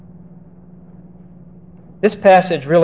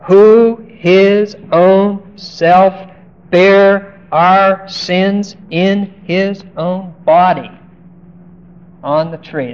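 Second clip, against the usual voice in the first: each word drawn out slow and louder, higher; solemn slow scripture reading; 4 semitones higher, 4 dB louder, much slower than usual. Acted. Preacher reading the verse to the congregation, slow and emphatic. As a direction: lean hard on every word of the verse.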